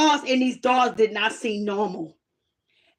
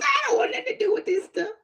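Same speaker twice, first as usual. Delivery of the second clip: high cracked wail, much higher and fast; mock crying wail; 10 semitones higher, 4 dB quieter, faster than usual. Little girl wailing at the doll she doesn't want. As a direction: wail it like a child refusing.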